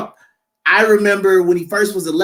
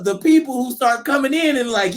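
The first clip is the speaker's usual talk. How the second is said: ordinary talk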